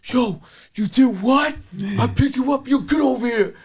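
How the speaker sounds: high, loud imitation of an outraged father; angry father. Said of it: "And my father goes".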